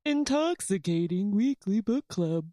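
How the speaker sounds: lightly put-on podcast host voice; playful copy of a host's sign-on; imitating co-host. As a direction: mimic your co-host's tagline read. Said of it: "Where I was pretending to be you".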